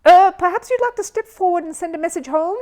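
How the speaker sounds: high, loud, rushed change of subject; hurriedly. Acted. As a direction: hurry past the awkward moment. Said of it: "said the Master of Ceremonies hurriedly".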